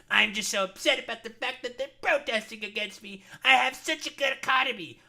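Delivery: high, loud whiny mock Trump voice, rising to a squeal. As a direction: ham up a petulant baby-man boasting. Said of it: "He's saying, like".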